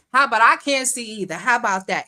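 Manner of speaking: normal talking voice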